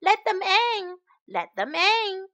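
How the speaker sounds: high-pitched pleading cub call; lion children calling. Acted. Lion cubs begging their parents, calling out high. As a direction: call out eagerly like little children. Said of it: "called the little lion children".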